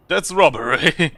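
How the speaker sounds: loud, drawn-out cry of outrage; outraged protest. Acted. Traveller protesting the balloon seller's price, outraged. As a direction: protest loudly, stretching it out. Reader acts an outraged customer.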